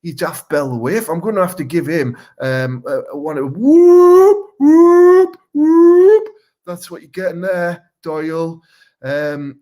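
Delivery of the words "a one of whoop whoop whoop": high, loud, drawn-out siren whoop. Acted. Batman gives the caller a siren. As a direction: wail it like a police siren.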